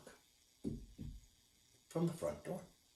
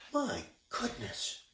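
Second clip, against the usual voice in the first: put-on old woman's dismay; 12 semitones higher, 4 dB quieter than usual. A narrator's reading. Old woman surveying the room.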